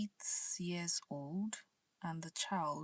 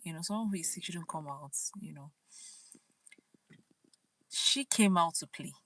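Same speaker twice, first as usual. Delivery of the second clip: normal talking voice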